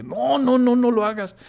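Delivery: negativa mucho más aguda, con los "no" encadenados; súplica alarmada. Retold or imitated. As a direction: suplica que no lo haga, alzando mucho el tono. Suplica alarmado que no acelere. (súplica alarmada en tono agudo)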